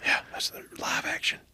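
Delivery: hushed whisper, playing along; voiceless, secretive reply; playful whisper. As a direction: whisper back as if on a hunt. Plays along with whispered exchange.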